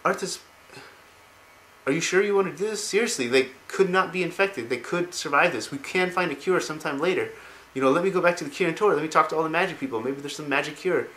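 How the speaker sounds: retold pleading. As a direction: plead for another way, lightly acted. Jaina pleads for a cure.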